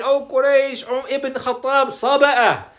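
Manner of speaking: lighter, high imitation of calling out; retold scream. Jamil announcing to Quraysh, mild imitated scream. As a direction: mildly re-enact a public announcement, pitched high. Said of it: "he screamed at the loudest voice".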